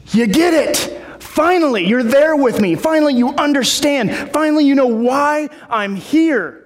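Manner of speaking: high, excited relief; jubilant, pitched well up; acted elation. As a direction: exclaim as if pupils finally got it. Acts out Jesus finally being understood.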